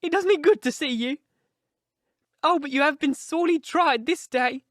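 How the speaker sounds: put-on high, emotional old-woman voice; overwrought, tearful. A narrator's reading. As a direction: pour it out as a distraught old housekeeper. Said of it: "she cried with a hysterical sob".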